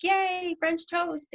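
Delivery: high-pitched delighted mom cheer; acted-out happy yay. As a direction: cheer for breakfast in a high, sing-out voice. Cheering child's breakfast arriving.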